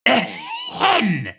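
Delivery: booming, exaggerated ahem; loud throat clearing. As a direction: clear the throat loudly for attention. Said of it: "I know that clearing of the throat".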